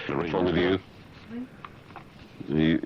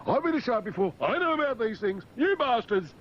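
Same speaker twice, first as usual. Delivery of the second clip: high-pitched put-on cartoon shark voice; indignant shark. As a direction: play an outraged shark protesting in a high voice. Voices indignant shark fleeing tourists.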